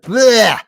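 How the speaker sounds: mock disgusted gag. Disgust at plain turkey.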